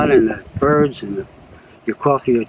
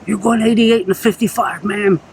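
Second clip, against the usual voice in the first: lightly voiced small-town deputy, pitched higher; 8 semitones higher than usual. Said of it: "he goes".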